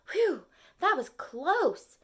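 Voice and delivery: high, slow, relieved puff of words; catching his breath. Acted. Rescued turtle catching his breath, high and slow. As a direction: blow it out like you just survived. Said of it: "while catching his breath".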